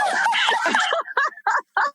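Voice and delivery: big high laugh. Real, not acted. Guest laughing hard after her joke to husband.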